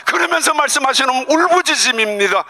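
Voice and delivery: high, ringing impassioned preaching; fervent preacher's climax; preaching at full force. Acted. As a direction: pour it out fervently.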